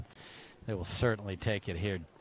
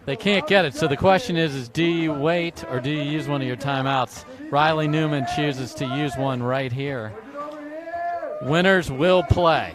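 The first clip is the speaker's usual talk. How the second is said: louder, higher excited call; excited commentary; 5 semitones higher, 8 dB louder than usual. Real, not acted. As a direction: build the hype as you call it. Excited call before timeout.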